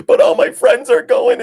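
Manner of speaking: very high, loud mock preteen protest; hammed-up girlish complaint, squeaked high; whining daughter. Daughter protesting the trip to dad, mock high whine. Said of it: "she's, like, freaking out".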